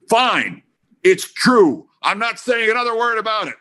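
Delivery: high, emphatic stagey indignation; mock exasperated outburst. [name] pretending to give in before storming off, acted exasperation.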